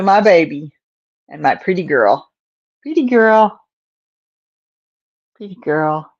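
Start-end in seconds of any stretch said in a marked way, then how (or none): 2.8-3.6 higher pet praise. Real, not acted. Praising the cat. 5.3-6.1 soft murmur. Real, not acted. Praising the cat.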